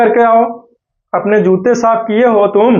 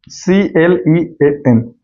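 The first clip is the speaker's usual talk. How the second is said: spelled out; 4 semitones lower, much slower than usual. Shown as a demonstration. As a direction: spell it slowly, letter by letter. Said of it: "clean". (अक्षर-अक्षर धीरे बोलना)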